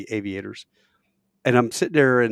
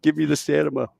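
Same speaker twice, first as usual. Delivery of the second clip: higher, louder begging; mock pleading; 6 semitones higher, 8 dB louder than usual. Student begging Susan's brains for an exam, mock pleading. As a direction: beg for help, half laughing. Acting out plea before an exam.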